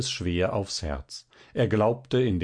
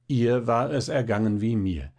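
plain reading voice

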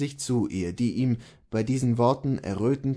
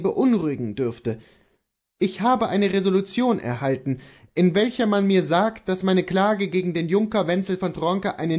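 higher, earnest husband's voice; Kohlhaas the husband; 7 semitones higher than usual. Acted. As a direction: explain your resolve to your wife, pitched up.

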